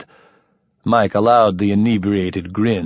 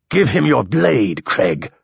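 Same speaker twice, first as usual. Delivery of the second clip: snapped; 4 semitones higher than usual. A narrator's reading. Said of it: "snapped Bertol".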